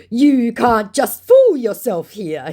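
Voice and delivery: slow, high, loud impression; mock indignant scammer. Scammer scolding the baiter, mock indignant. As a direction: stretch the retort in mock outrage.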